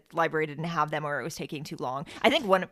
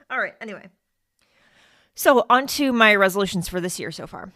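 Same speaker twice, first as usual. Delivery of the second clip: ordinary talk, nothing marked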